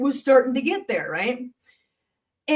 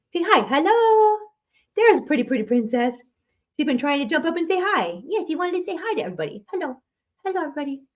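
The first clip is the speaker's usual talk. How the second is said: high pet voice saying hello for the cat; 4 semitones higher than usual. Real, not acted. Baby talk to cat.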